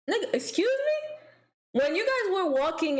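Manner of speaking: high indignant excuse me; mock outrage at being kicked out; indignant disbelief. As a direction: jump up high in offended disbelief. Indignant reaction to guard.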